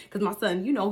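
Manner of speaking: usual speaking voice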